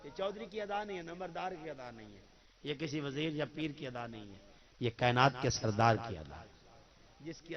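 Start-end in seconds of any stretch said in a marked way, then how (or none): none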